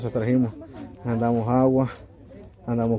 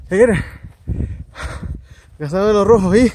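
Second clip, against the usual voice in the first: loud over the wind; 7 semitones higher, 9 dB louder than usual. Real, not acted. Windy cold summit at night.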